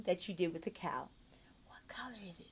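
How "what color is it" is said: whispered question; soft secret question; hushed teacher voice. Shown as a demonstration. Teacher demo.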